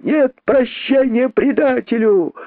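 high slow condemning cry; angry crowd. A narrator's reading. Rabbits condemning the traitor, angry. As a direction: refuse him mercy. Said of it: "отвечали кролики".

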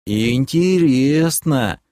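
slow, stretched-out musing; thoughtful drawl. Acted. Orbit eyes the demoness, thoughtfully drawling. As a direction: draw the word out pensively. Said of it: "задумчиво протянул Орбит".

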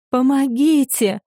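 sobbing cry for help. Acted. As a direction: burst into tears as you beg. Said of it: "уже в голос заплакала девушка".

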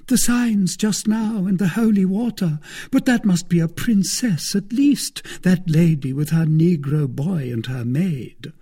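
an eager wife pressing her case; lawyer's wife voiced high. A narrator's reading.

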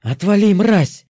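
злобный рявк ведьмы, громко; рявкни со злостью; рявкнула. A narrator's reading. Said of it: "гаркнула ведьма". (яростный рявк)

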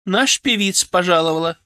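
выше и громче, радостно восклицая; выкрикни с торжеством; восклицание. A narrator's reading. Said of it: "воскликнула Лидочка". (звонкое восклицание)

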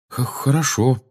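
put-on hesitant boy answering; hesitant stammer. A narrator's reading. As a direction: stumble on the first sound, unsure. Shy Harry stammers an answer.

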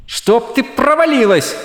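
high, fed-up curse; exasperated. A narrator's reading. Shallan's exasperated thought.

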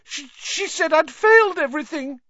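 high, loud, stammering sob-voice; distraught. A narrator's reading. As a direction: wail it out in panic. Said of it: "Hermione gasped".

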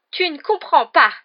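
high, drawn-out loud wail. A narrator's reading. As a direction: wail in desperate protest. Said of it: "gémit Petit Gros". (gémissement désespéré)

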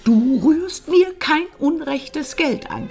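slow, insistent warning; stern order. Acted. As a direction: lay down the law, drawing out the words. Firm order about the money.

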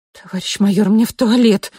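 urgent loud blurt. Acted. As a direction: blurt it out in a rush. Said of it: "выпалила".

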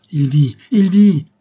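loud, slow, high cry of joy; joyful cry. A narrator's reading. Uncle crying out that his nephew lives, loud and joyful. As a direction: cry it out with relief. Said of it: "s'écria-t-il".

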